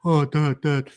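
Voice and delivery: put-on nagging daughter; comic daughter voice. Host mocks daughter nagging about telly, comic voice. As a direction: mimic a daughter pestering Dad. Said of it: "for comedic purposes. That's how she talks".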